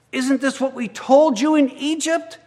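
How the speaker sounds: high, loud sarcastic challenge. A narrator's reading.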